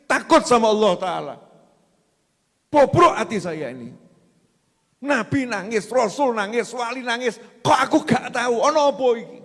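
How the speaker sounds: loud, high lament to a religious teacher; hammed-up, forceful self-reproach; acted anguished complaint. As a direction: cry out for help like a troubled worshipper. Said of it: "Cari orang yang ngeluh".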